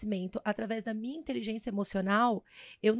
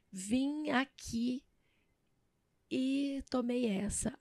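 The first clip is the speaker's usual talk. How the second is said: dejected; 3 dB quieter, much slower than usual. Retold or imitated. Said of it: "Aí eu falei assim".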